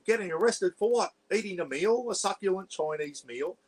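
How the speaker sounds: high-pitched, indignant mock-posh English; theatrical posh English outrage; posh Pommy accent. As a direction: protest like an arrested posh Englishman. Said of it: "like a posh Pommy accent".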